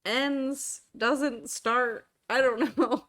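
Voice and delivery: loud, laughing exasperation; laughing rant. Real, not acted. Laughing rant about daylight savings.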